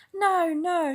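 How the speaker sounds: high, loud protest; crowd shout. A narrator's reading. The crowd shouts their denial, loud. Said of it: "shouted most of the crowd".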